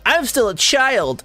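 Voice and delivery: high, drawn-out mock kid whine; mocking childish protest. Mimicking immature new adults.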